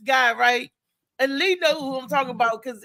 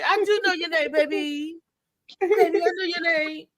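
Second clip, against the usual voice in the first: mock drunk pimp; 3 semitones higher than usual. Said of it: "he be drunker than a skunk".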